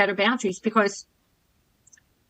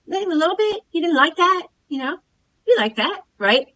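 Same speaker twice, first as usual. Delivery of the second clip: suggestive coaxing; 7 semitones higher than usual. Retold or imitated. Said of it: "He goes".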